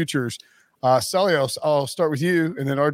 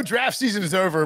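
usual speaking voice

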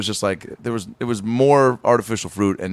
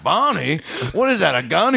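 higher alarmed cartoon voice; startled Fred; 5 semitones higher than usual. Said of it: "he's like".

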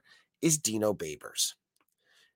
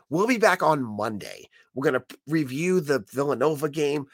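ordinary talk

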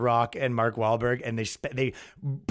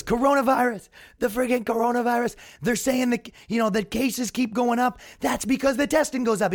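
rough impression pitched well above your own voice; Trump impression; 9 semitones higher than usual. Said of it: "I'm not gonna do a good Trump impression".

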